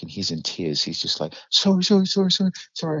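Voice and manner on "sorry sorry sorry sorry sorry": much higher, whimpering imitation of a crying kid; tearful begging. Kid in tears under his boot begging, high and whimpering. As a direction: squeak out frantic apologies.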